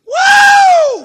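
high, loud whoop of joy; ecstatic whoop. Real, not acted. Preacher whooping in the pulpit. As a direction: let out a joyful church whoop.

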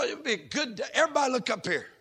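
normal talking voice

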